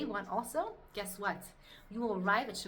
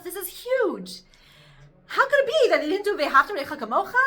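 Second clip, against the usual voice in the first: excited question; 10 semitones higher, 13 dB louder than usual. Retold or imitated. Acting out the question, rising excitedly.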